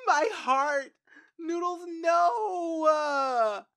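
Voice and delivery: loud, very slow, high wail of grief; a real wailing sob over a lost pet; real crying wail. Real, not acted. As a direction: cry the name out as if heartbroken. Grieving the dead pet.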